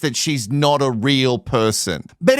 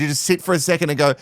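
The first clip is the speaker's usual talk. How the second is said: usual speaking voice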